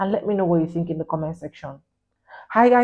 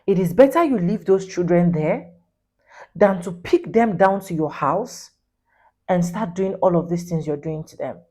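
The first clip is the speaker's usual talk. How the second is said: ordinary talk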